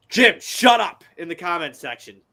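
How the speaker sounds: loud, high telling-off through amusement; amused scolding. Real, not acted. Podcaster to Jim in the comment section, amused loud scolding. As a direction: scold a friend who cracked you up. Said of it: "You're making me laugh".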